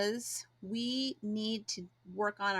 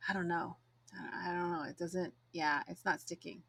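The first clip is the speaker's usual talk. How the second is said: deflated doubt; 3 semitones lower, 6 dB quieter than usual. Retold or imitated. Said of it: "where you're just like".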